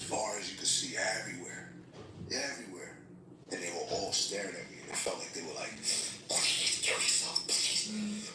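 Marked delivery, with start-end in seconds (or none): 6.3-8.3 slow, voiceless plea rising high; begging. Retold or imitated. Staring shadows urge him to harm himself, imitated eerie begging. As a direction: beg in a drawn-out eerie voice. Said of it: "like if someone's begging you".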